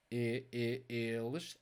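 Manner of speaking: "eles" with a closed E, the vowel sounded out first. Shown as a demonstration. Teacher contrasting vowels for learners, closed E in "eles". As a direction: isolate the closed E, then say "eles". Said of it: "do you hear?".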